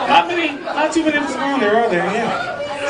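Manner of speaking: ordinary talk, nothing marked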